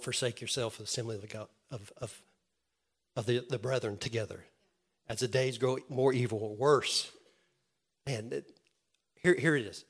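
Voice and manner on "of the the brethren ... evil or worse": ordinary talk, nothing marked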